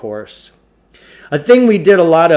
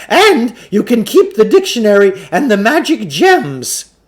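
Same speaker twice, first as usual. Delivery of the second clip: higher, louder huckster calling out a last pitch; calling after them as Klugarsh; 9 semitones higher, 7 dB louder than usual. Acted. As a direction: shout the sales pitch after the leaving boys. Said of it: "he called out after us".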